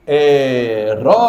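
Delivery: "Economicamente" drawn out very slowly, syllables spaced. Shown as a demonstration. Teacher slowing down to show the stressed syllable. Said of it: "a que tem a mesma sílaba tônica".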